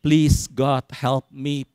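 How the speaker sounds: low, slow, unforced prayer; humble plea. Acted. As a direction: beg God meekly. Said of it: "minta dalam kerendahan hati". (berdoa dengan rendah hati)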